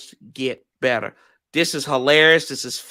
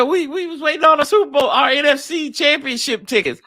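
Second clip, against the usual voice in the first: mock fan quote; 10 semitones higher than usual. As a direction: whine it like a deluded fan. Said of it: "They said".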